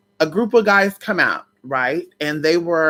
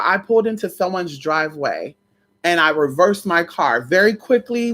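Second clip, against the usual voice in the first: ordinary talk, nothing marked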